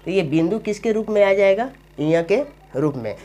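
usual speaking voice

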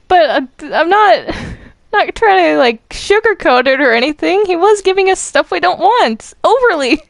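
riled up and defending herself to Popcorn; worked up. Real, not acted. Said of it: "Slow down".

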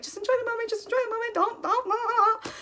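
high, urgent self-talk telling herself to calm down; self pep talk. Retold or imitated. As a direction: coach yourself in a squeaky plea. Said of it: "So I was like".

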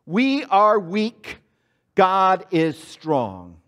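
very slow, spaced words to echo; slow, spaced congregational chant; slow call to repeat. Shown as a demonstration. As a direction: draw it out for the congregation. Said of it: "Say that with me".

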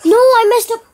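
loud, high outburst of dismay; frustrated yell. Real, not acted. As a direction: yell at the mistake. Messes up in game, yells.

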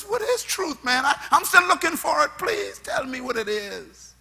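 high, searching plea, easing off at the end; a ruler begging for an answer; pleading Pilate. Retold or imitated. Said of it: "And he said to Jesus".

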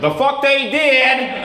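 high, loud, drawn-out Southern holler; attempted Southern accent. Comic as a guy in the audience objecting, bad Southern accent. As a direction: shout it slowly in an exaggerated Southern accent. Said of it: "I can't do a Southern accent".

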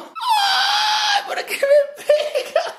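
shrill mock pain cry; high yelp. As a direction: squeal at the touch. Sister touches fake wound.

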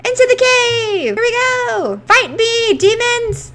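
high, loud hammed-up charge; battle cry. Gamer charging into the cave at the demons, battle cry. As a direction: yell it like a hero charging in.

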